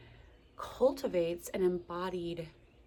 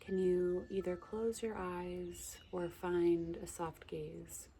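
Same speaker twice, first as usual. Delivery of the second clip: guided meditation; 4 dB quieter than usual. Acted. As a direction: gently guide the listener's breath. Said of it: "a little guided meditation for you".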